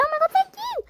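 very high, overjoyed squeal; squealing thanks. Acted. Thanking a hardworking-video comment, squealing delightedly. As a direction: squeal it like an excited fan.

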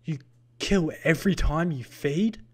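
loud, high, appalled question; shocked gasp. A narrator's reading. Larton appalled at how the Vampanese feed, gasping. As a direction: blurt it out in horror. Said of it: "Larton gasped".

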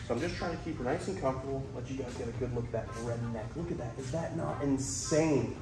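hushed nature-show host voice; excited wildlife host whisper. Real, not acted.

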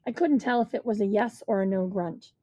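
ordinary delivery

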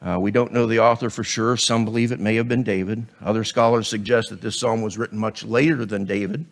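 normal talking voice

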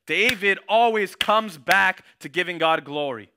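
slow, loud preacher declaration; emphatic preaching. Acted. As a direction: drive the point home to the congregation. Preacher drives home point.

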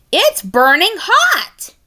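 high, slow startled cry. Acted. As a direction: pull back from the hot can and cry out. Chuck burns his beak.

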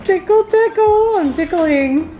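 high playful singsong; giggly tickle attack. Real, not acted. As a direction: coo it out high and slow.

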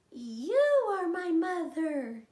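put-on baby bird overjoyed. Baby bird thinking the digger is his mother, slow loud joyful chick voice. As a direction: cry it out with joy. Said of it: "he said".

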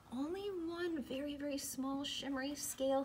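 soft inner voice; thinking quietly. Acted. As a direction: say it softly to yourself. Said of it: "he thought".

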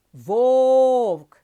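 Вовк said loud with the О stretched long; drawn-out vowel. Shown as a demonstration. Teacher to pupils, loudly stretching the vowel. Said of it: "голосний звук".

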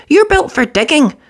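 high brisk engine voice; Thomas voice. Acted. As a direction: brush him off kindly. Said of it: "Thomas puffed".

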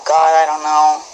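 normal talking voice

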